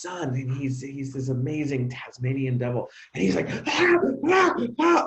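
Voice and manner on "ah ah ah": high, loud mock toddler shrieks; imitating a screaming toddler. A father mimicking his excited son, loud and high. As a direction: do the little son's happy screaming. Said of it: "all day, just screaming".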